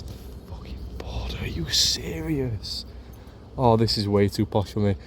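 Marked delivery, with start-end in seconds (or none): 0.0-1.4 awed whisper; hushed disbelief, breathed. Real, not acted. Stunned by view across border. 1.4-2.8 slow, higher disbelief; drawn-out amazed question; can't believe the view; stunned. Real, not acted. Stunned by view across border.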